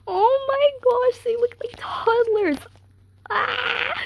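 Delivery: cooing squeal. Real, not acted. Fan gushing at cute photos.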